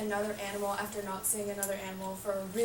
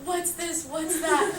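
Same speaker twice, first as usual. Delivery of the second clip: higher-pitched retold wonder; playfully acted, rising excited questions; freaked-out excitement; 5 semitones higher than usual. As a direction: mimic being amazed by every new plant. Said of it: "I freaked out".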